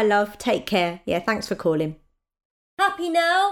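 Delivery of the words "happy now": higher, pointed happy now; wife teasing husband after hanging up; sarcastic. Real, not acted. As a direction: needle him with it.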